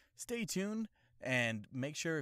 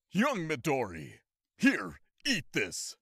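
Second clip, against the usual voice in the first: anime mentor voice; 5 semitones higher, 6 dB louder, slower than usual. Retold or imitated. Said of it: "anime impression video".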